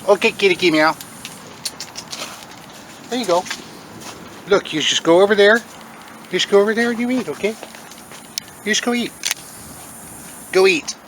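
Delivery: coaxing the cat to come eat. Real, not acted.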